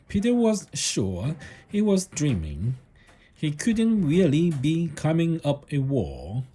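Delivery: ordinary delivery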